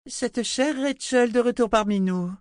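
very high, sugary mockery; simpering. A narrator's reading. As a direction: purr it falsely sweet. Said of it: "minauda Violette".

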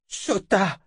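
high-pitched outburst of her name; sharp angry exclamation. Acted. Angry confrontation, sorcerer calls out.

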